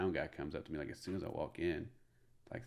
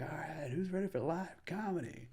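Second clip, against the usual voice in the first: stage hype call; 11 semitones higher than usual. Retold or imitated. Comic asks crowd about live comedy, acted hype call. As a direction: pitch it up to rally the room. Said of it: "then I scream again".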